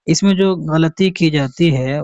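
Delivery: ordinary talk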